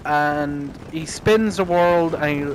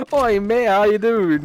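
high, louder mock Aussie; Australian accent; 5 semitones higher, 5 dB louder than usual. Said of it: "Australian accent".

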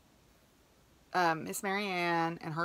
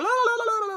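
much higher, louder mock blah-blah rant; mock babble; 8 dB louder than usual. Teller mocks her own rambling on the call, blah-blah-blah high and loud. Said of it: "I just went blah".

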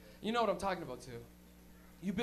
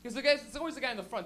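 ordinary delivery

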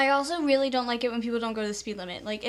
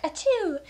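polite mock sneeze; 4 semitones higher than usual. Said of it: "be like".

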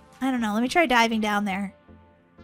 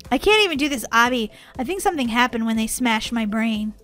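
ordinary talk

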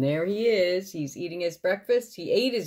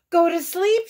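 high, loud exclamation; shout; 12 semitones higher, 8 dB louder than usual. A narrator's reading. Toad reacting to Frog's bedtime remark, shouting. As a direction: shout "Go to sleep" in sudden realisation. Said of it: "shouted Toad".

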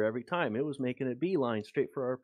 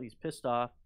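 ordinary talk, nothing marked

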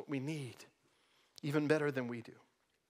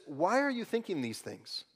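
normal talking voice

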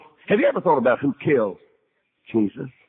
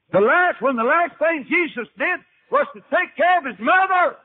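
preaching at full force; 14 semitones higher than usual. Real, not acted. Preacher's climax on the cross.

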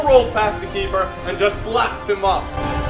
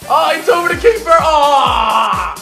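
rising, loud anticipation; excited shout; 5 semitones higher, 7 dB louder, slower than usual. Real, not acted. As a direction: shout as the ball flies. Shot flies toward goal.

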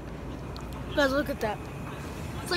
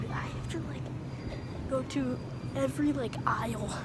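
whisper; 3 semitones lower, 11 dB quieter than usual. Real, not acted. Sneaking through store aisles.

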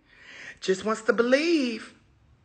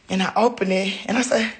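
ordinary talk, nothing marked

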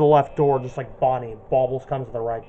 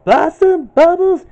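much higher, loud cartoon girl calling; Buttercup impression; 7 dB louder, much slower than usual. Gamer imitates Buttercup searching for sisters, high and loud. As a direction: call the sisters as Buttercup, pitched way up. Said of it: "Boss Buttercup's voice".

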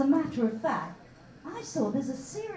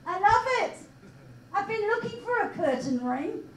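mock delight; 7 semitones higher than usual. Mother mocking her daughter's nose ring, sarcastic gush. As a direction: pretend to love it while mocking it.

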